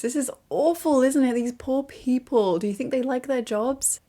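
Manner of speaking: high-pitched, hammed-up concern; a touch of melodramatic pity; mock distress. Playing up concern for costumed performers.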